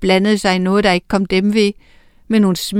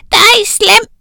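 put-on very high, loud toddler wail; shrill-free but very high, loud baby cry; bawling baby witch; 12 semitones higher, 8 dB louder than usual. A narrator's reading. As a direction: bawl like a cross little baby witch. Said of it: "vrælede hun".

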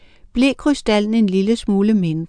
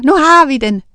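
triumphant; 5 semitones higher, 6 dB louder than usual. A narrator's reading. Witches cheering together.

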